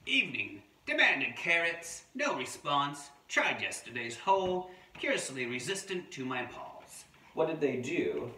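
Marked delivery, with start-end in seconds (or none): 0.0-7.0 punchy amateur Australian wombat log; attempted Australian accent. Adult reading a wombat's diary to children, makeshift Australian accent. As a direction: report each setback in pretend Australian. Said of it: "not sure if this is a real Australian voice".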